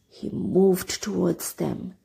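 ordinary delivery, nothing marked

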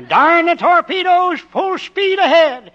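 loud, high and slow; grand battle cry. Acted. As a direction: proclaim it like an admiral charging. Farmer roars off theatrically.

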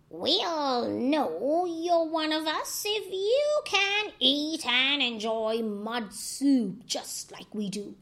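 lightly voiced old crocodile, slow. A narrator's reading. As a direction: set a test with a sly drawl. Oldest crocodile speaks.

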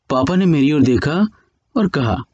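ordinary delivery

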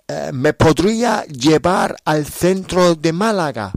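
a lively diary-story read aloud for Spanish learners; story narration. Acted. Teacher reads a graded story to listeners, lively narration. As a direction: tell the trip story clearly and with energy.

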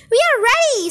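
high, eager storybook voice; eager chorus reply. A narrator's reading. Monkeys eagerly answer the leader.

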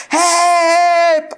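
high, loud, drawn-out call; shouting for help. A narrator's reading. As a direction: yell for anyone to hear. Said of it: "I shouted".

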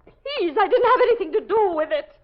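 high, loud, desperate pleading; frightened pleading. Acted. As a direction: beg him to believe you. Pleading her innocence, frightened.

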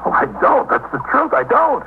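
high, strained, breaking denial; choked anguish. Acted. Husband, pressed by the detectives, denies knowing in high anguish. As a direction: squeeze it out, near tears.

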